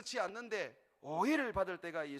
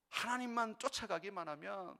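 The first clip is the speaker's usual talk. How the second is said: ordinary delivery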